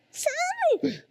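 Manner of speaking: a sudden high squeal of laughter; high laughing burst. Real, not acted. Pastor laughs at own joke.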